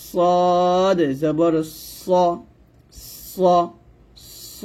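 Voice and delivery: সদ slow and heavy, rounded s; thick, full স, stretched out; thick sod. Shown as a demonstration. Teacher demonstrating the thick letter, thick sod. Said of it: "সদ হবে মোটা করে".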